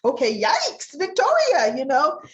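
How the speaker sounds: high, startled yikes; shocked. Real, not acted. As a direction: blurt out shocked disbelief. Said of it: "I was like".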